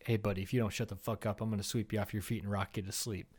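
low, quiet, unbothered warning; calm understated menace; deadpan threat. Acted. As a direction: threaten softly, as if it were nothing. Acted deadpan threat to would-be fighter.

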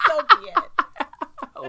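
loud, high, slow words through laughter; laughing. Real, not acted. Co-host called a follower, laughing surrender. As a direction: crack up while giving in. Both cracking up after teasing.

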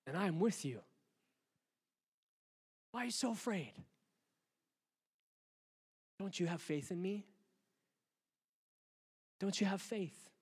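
tender divine reassurance. Acted. God gently reassuring his frightened child, softly. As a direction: ease down into a tender plea. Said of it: "God's reply".

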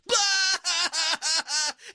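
high, loud burst of mimicked laughter; imitated laugh. Imitating dad laughing hard over the phone, high loud laugh. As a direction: play dad cracking up on the phone. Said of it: "he's laughing his ass off".

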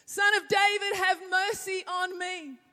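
high, loud desperate shout; a beggar shouting to Jesus over the crowd, pleading loudly; crying out. Acted. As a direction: yell it across the crowd as the beggar. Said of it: "he called out".

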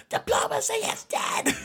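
high mock punk singer voice; imitating the singer. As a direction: squeal it like a punk frontman. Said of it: "I'm used to him going".

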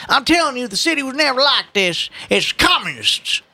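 high crotchety grumble, dragging the final words; old man. Acted. Old man blaming communists for the city's decline, crotchety. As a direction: rail like an aggrieved old-timer. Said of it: "the old man said again".

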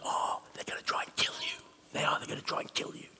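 soft unvoiced rumor whisper. Rumor-mongers warning Nehemiah, hushed whisper. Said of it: "whispered rumors".

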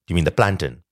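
plantain with an unstressed "tin" ending; short-ending plantain. Retold or imitated. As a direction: answer back with PLAN-tin. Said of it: "And she said".

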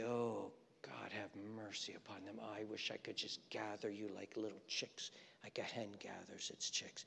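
soft, hushed tearful plea; weeping prayer. Acted. Jesus over Jerusalem, soft tearful whisper. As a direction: let it fall to a grieving whisper. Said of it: "and weep and say".

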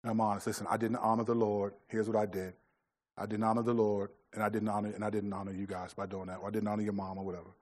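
put-on father apologizing to his kids; humble apology. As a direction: ask the family's forgiveness humbly. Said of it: "humble myself and say".

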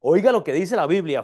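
ordinary delivery

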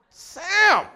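sudden high loud yell of Sam!; yelling a kid's name. Retold or imitated. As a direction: call out Sam! like a fed-up parent. Parent yelling at son.

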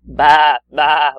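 loud bleating bah; sheep bleat. Pretend. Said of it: "like that".

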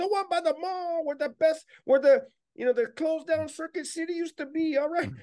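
squeaky tough-guy boast; tough gang member voice. Retold or imitated. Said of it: "I would do the voices back and forth".

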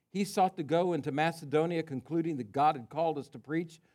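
usual speaking voice

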